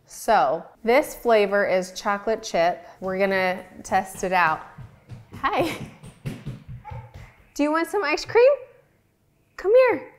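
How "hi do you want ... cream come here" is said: high sing-song voice calling a pet; high-pitched cooing to a pet; pet talk. Real, not acted. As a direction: coax the pet over, pitched way up. Offering ice cream to pet.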